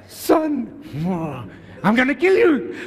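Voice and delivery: hammed-up mother scolding through a smile; angry mom look. Mary threatening her son Jesus, hammed-up mom voice. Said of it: "You know the mom's look".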